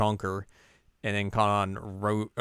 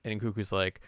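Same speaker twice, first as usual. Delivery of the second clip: usual speaking voice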